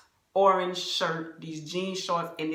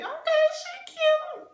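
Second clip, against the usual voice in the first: much higher, drawn-out gossiping voice; high, slow mock onlooker; 4 dB louder, slower than usual. Onlookers at the restaurant judging her, high mock gossip. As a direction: say it like a nosy onlooker sizing her up. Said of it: "you know what they thinking".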